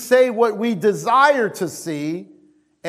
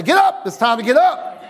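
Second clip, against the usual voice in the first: loud, higher laughing order; laughing shout; 5 semitones higher, 3 dB louder than usual. Retold or imitated. Preacher ordering his sleepy back to get up, laughing shout. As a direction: bark it at your lazy body, laughing. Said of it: "I say".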